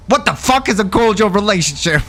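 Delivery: high-pitched acted outrage; worked-up, incredulous retelling; indignant retort. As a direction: throw the question back at him in disbelief.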